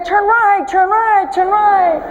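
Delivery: mock navigation voice, a touch mechanical; GPS voice. Preacher mimics one car GPS giving directions, mild mock GPS voice. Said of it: "The other would say".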